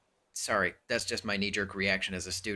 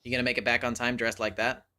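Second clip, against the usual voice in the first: ordinary delivery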